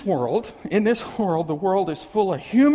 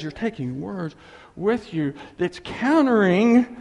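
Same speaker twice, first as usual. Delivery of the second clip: ordinary delivery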